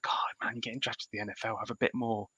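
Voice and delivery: hushed, fast disbelieving whisper at a player; exasperated whisper. Retold or imitated. Host imagining what he'd tell the drafted player, hushed and urgent. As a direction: whisper it, urging him to react. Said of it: "I'm like".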